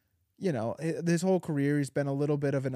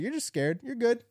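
put-on soothing baby; 10 semitones higher than usual. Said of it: "I was like".

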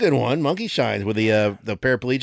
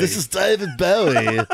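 higher put-on voice; 7 semitones higher than usual. Shown as a demonstration. Host repeating his celebrity voice, mock impression. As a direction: do the singer playfully, pitched up. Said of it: "or I can do, like".

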